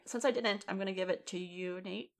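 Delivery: normal talking voice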